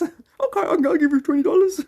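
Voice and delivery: high, eager put-on voice; gullible internet guy. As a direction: agree far too eagerly, pitch way up. Gullible guy answers nice woman.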